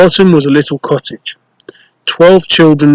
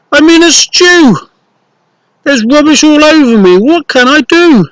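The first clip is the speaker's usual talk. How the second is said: put-on much higher, fretful little-girl voice; distressed girl; 11 semitones higher than usual. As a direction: wail about the rubbish. Nessie stuck in rubbish.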